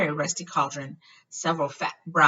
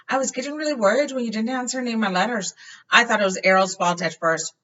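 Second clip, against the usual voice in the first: higher, fast worried Ron; 6 semitones higher, 5 dB louder, faster than usual. A narrator's reading. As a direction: rush it out like anxious Ron. Ron voiced.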